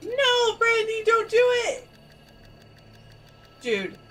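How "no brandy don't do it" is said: high, loud, desperate plea; shouting. Real, not acted. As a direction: yell to stop her. Pleading with player not to act.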